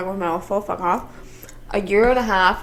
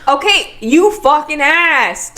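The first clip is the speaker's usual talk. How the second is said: loud exasperated; 7 semitones higher, 7 dB louder, much slower than usual. Real, not acted. Mock quarrel between friends.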